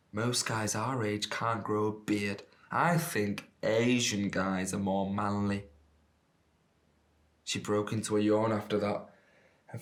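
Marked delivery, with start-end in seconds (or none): 0.1-5.7 slow, drawn-out drawl; slow tipsy drawl; drunken drawl. Acted. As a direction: stretch the words out like a tipsy flirt. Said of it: "she drawled at me".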